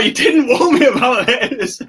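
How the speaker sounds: laughing. Real, not acted. Laughs at surprise forfeit.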